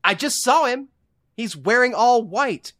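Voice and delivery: high-pitched, incredulous comic outburst; exasperated rant. Real, not acted. Mock exasperated rant about witness.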